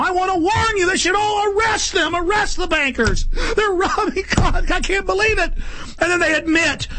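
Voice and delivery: very high, shouted rant; real alarmed shouting; frantic shouting. Real, not acted. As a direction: yell the warning as if it can't wait. Ranting warning against bankers.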